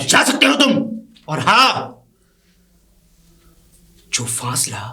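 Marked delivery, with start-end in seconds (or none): 0.0-1.9 loud, high contemptuous dismissal; angry dismissal. Acted. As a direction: throw him out of the room with your voice. Master dismisses servant with contempt.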